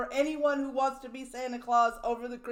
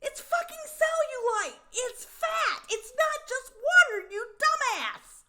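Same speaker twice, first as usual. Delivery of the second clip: high-pitched exasperated yell; 10 semitones higher than usual. Real, not acted. Ranting at the vlogger about his belly, high laughing yell. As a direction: shriek in disbelief, half laughing. Mocking a man who says "water.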